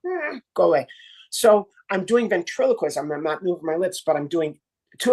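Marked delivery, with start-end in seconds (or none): 0.1-0.9 high, brushing off from far away; thrown voice. Shown as a demonstration. Ventriloquist demo, shooing the imagined woman, high. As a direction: wave her off from a distance.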